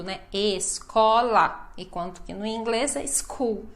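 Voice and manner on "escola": very slow ESCOLA, es, co, la; every syllable pronounced. Shown as a demonstration. Teacher insisting everything must be said, every syllable pronounced. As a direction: stretch each syllable of escola in full. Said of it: "Nós temos que falar tudo".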